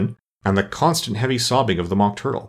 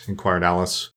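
usual speaking voice

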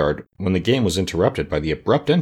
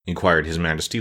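ordinary delivery

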